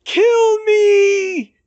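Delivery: long, agonised high scream; throat-tearing shout. A narrator's reading. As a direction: scream it with all your might. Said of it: "Bill's shout was so loud".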